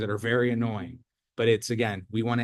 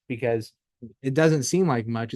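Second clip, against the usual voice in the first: ordinary talk, nothing marked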